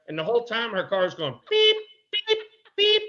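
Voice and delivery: high beeping mimic; car alarm imitation. Will mimics the car alarm beeping, laughing. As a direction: chirp the beeps like an alarm.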